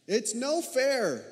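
slow, high, loud whining protest; childish whine. Pretend. Pastor mimicking a child who didn't get his way, whining. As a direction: whine like a thwarted kid.

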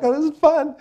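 put-on squeaky high giggly toddler voice; little girl voice. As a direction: play a delighted two-year-old. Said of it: "it's like".

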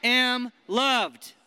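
slow, loud and high affirmation; loud declaration. Shown as a demonstration. Preacher leads the room in declaring, slow and loud. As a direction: shout it like you believe it. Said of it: "Let's say it again".

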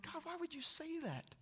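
high-pitched exasperated whine; reenacted frustrated complaint to God. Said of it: "I was frustrated. I'm like".

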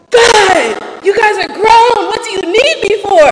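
an exasperated mother shouting down the phone; mom's exasperated shout. Retold or imitated. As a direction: yell it like a fed-up mom. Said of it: "The second type of way she answers the phone is".

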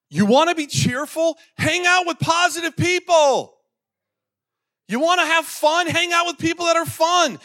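very high, loud exhortation; fervent preaching. Real, not acted. Preacher urging church to pick positive friends, fervent. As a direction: drive each point home at full force.

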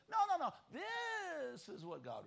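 high, emphatic contradiction, then a drawn-out assertion; challenging. Retold or imitated. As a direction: cut in high and insistent, then stretch THIS. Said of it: "And then he will challenge".

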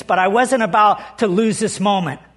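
ordinary talk, nothing marked

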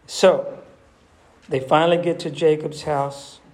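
ordinary talk, nothing marked

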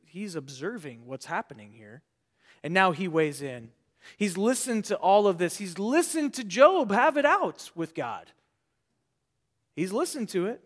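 normal talking voice

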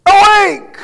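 high, loud cry of awake; prophet's shout. Retold or imitated. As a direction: cry out like a prophet rousing a nation. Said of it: "Isaiah shouts".

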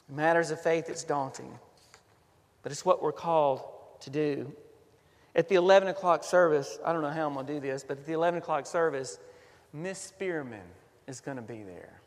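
usual speaking voice

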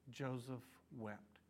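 a slow, hushed "Joseph wept"; softened grief. Acted. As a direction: let it drop to near silence, slowly. Tender reading of Joseph weeping.

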